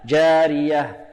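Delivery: جارية chanted slowly, final h breathed out audibly; slow recited جارية with distinct closing h; clearly sounded final ha. Shown as a demonstration. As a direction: draw out جارية and release a clear h. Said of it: "لابد من إيضاح الهاء".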